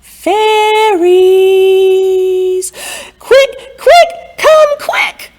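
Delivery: high, loud urgent holler. Shown as a demonstration. Wise woman shows how to holler for the fairies, high and loud. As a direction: yell for the fairies to come quick. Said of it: "I want you to holler out".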